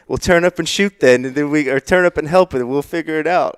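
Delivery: loud, high mock English accent; hammed-up, badly faked English accent. Reading the club boss's email, bad English accent. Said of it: "in the English accent".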